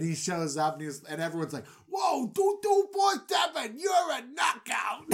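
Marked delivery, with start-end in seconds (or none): 1.9-5.0 high, shouted mock wow; amazed shout. Guards gasp at made-over Joseph.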